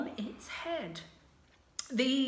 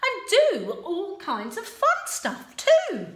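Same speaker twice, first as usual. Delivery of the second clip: high, loud and quick; eager princess, higher and louder; impatient Sue; 7 semitones higher, 6 dB louder, slower than usual. Acted. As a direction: hurry him along. Sue speaking.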